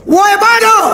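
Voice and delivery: loud, high shout of "Woi bodoh!"; a mock insult yelled across the street; Kupang-style yell. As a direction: holler it like a Kupang local scolding a fool. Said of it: "Orang Kupang".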